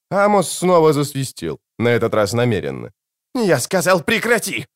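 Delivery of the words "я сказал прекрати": high-pitched, irritated growl without much volume; growled order. A narrator's reading. Prisoner ordering the whistler to stop, an acted growl. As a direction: growl it to make the noise stop. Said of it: "зарычал Конёчек".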